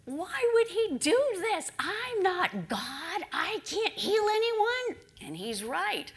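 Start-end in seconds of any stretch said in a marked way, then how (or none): 0.0-5.0 loud, high-pitched indignant protest; acted royal outburst, much higher and louder; upset outburst. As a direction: cry out in exasperated outrage. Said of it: "He's really upset".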